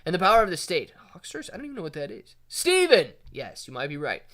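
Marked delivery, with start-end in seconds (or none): 2.5-3.1 calling out a name. Real, not acted.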